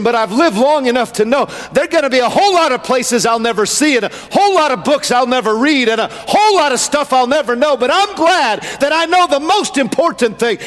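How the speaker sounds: impassioned pulpit delivery, high and driving; fervent high-pitched sermon cadence; full-force preaching. Real, not acted. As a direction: preach it in long rolling phrases.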